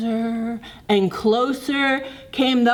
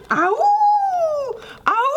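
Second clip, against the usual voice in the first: pained cry. Acted. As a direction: yelp ow from somewhere unseen. Mystery creature crying out.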